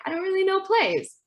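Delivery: high-pitched imitation of community members; lightly hammed-up, high protest; mock clueless community. Acting out the community's complaint.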